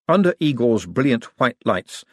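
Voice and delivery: ordinary delivery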